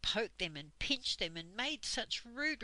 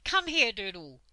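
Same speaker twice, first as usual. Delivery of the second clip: louder, hard command; stern summons; 2 semitones higher, 9 dB louder than usual. A narrator's reading. Dame Slap summoning Doodle for a slap, stern. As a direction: order him over, no mercy.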